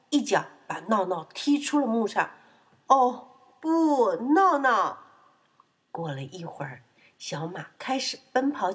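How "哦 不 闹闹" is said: high, loud, drawn-out alarm; dismayed outcry. Acted. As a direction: cry out in mock horror. Refrain of dismay at piglet. (夸张地高声惊呼)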